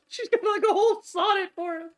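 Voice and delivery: high, laughing squeal of disbelief. Real, not acted. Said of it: "laughing disbelief".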